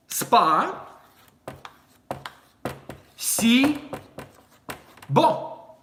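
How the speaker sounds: slow "Spa, si, bo" with pauses; slow teaching split; syllable by syllable. Shown as a demonstration. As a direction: break it into spa, si, bo. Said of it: "Like this".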